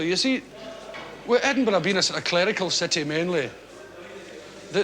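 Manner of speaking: usual speaking voice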